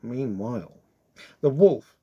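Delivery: ordinary delivery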